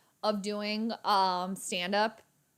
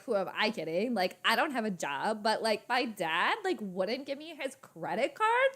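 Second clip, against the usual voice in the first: hammed-up Valley girl with swooping pitch; LA Valley accent. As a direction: put on an LA Valley accent, every phrase lilting. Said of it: "That's my L A accent".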